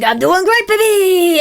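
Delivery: loud, high hype shout; hyped mantra. Pretend. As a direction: yell it like a cheerleader. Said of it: "you replace it with, like".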